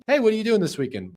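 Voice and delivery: high, quick casual ask; friendly question. Shown as a demonstration. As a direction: toss it out to a friend. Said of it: "verbal cues sound like this".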